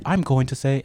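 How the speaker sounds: a light put-on British accent; mock British accent. Host introducing his hot take in a mild British accent. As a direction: start the segment in a playful British accent. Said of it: "let me switch it to American English".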